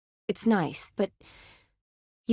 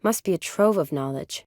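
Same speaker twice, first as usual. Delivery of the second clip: ordinary talk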